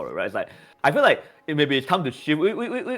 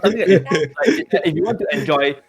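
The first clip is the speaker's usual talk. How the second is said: laughing; 7 semitones higher, 5 dB louder than usual. Real, not acted. Cracking up mid-sentence.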